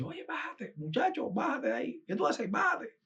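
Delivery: high, sharp call to get down off the stage; mock scolding from a man shooing a child away; a man at the stage ordering the boy down, high and sharp; shooing the kid off.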